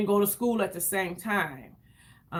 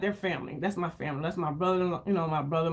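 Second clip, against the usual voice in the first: usual speaking voice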